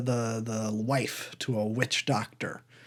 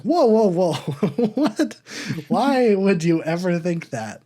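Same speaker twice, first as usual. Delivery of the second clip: high, loud, laughing incredulity; mock disbelief; 6 semitones higher, 5 dB louder than usual. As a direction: protest in playful shock, voice leaping up. Mock disbelief at film heroine's confession.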